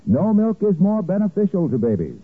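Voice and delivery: ordinary talk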